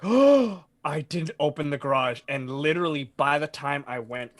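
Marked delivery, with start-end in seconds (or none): 0.0-2.2 mock gasp of dawning horror; retold shocked realization. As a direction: act out a sudden alarmed realization. Retelling his sudden alarmed realization.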